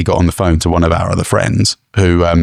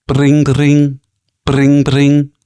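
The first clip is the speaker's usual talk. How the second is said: mock ringtone; 7 semitones higher, much slower than usual. Setting up the pizza role play, playful vocal ringtone. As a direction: bring ring phone ring, higher and sung out. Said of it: "do the ringtone".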